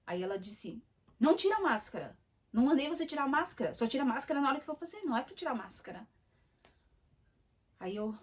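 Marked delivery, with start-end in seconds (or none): 1.2-6.1 louder, higher, fast scolding; rude scolding. Retold or imitated. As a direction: scold the patient sharply and fast. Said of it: "ela continuou estúpida comigo".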